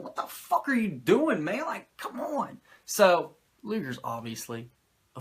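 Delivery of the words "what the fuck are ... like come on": high, incredulous mock complaint; exasperated Taskmaster. Taskmaster scolding Luger, high and incredulous. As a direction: throw up your hands at Luger, pitched high. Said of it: "Taskmaster's like".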